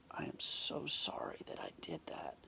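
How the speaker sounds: low, soft retold apology; hushed and contrite; apologetic. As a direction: drop the voice to say sorry.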